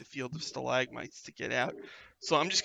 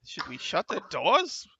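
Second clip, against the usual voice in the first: panicked hobgoblin; 8 semitones higher, 7 dB louder than usual. Acted.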